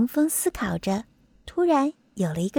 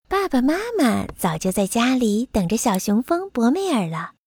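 plain reading voice

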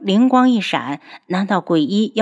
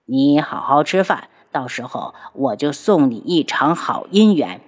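a lower, menacing male voice; ghost doctor voice; 4 semitones lower than usual. Acted. Ghost doctor coldly ordering the captive girl to eat, low menace. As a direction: keep it low and cold as the sinister doctor. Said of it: "这次听到了鬼医的声音".

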